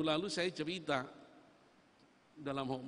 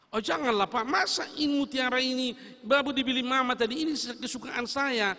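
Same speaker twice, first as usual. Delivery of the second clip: pleading daughter; 6 semitones higher, 6 dB louder than usual. Acted. Daughter pleading with her father.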